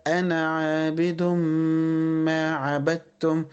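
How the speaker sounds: slow, sustained tajweed chant; Quran recitation. Shown as a demonstration. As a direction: recite slowly, holding the hum. Recites verse. (تلاوة مجوّدة بطيئة)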